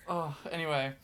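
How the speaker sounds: normal talking voice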